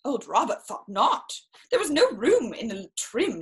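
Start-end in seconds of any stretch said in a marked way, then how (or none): none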